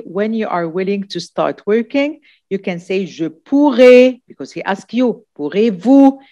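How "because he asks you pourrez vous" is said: normal talking voice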